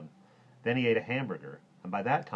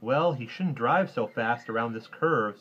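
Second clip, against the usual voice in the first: put-on slow, louder, doubtful boy; drawn-out, higher note of doubt; 4 semitones higher, 8 dB louder, slower than usual. A narrator's reading. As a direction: voice a kid's misgiving, slowly. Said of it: "sort of doubtful-like".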